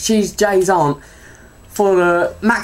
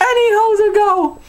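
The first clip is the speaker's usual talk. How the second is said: squealing the line through giggles; can't hold it together; high laughing repeat. Retold or imitated.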